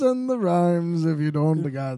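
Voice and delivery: stretched-out rhyming comedian impression; Bill Cosby. Co-host answers the rap challenge, Bill Cosby impression. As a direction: draw out the rhyme in character. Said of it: "how different our Bill Cosbys are".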